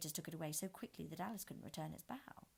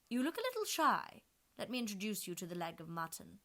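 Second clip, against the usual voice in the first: high, loud mocking queen; Red Queen voice; 3 semitones higher, 7 dB louder than usual. A narrator's reading. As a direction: tease the shy girl as the queen.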